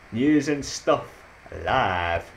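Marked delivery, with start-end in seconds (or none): 0.1-1.1 high and slow through a laugh; giggling at the gif. Real, not acted. Showing viewers a funny gif, laughing.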